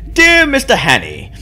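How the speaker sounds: hammed-up, high-pitched English letter reading; bad English accent. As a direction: read it out in a terrible put-on English accent. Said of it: "That's, like, the worst English accent".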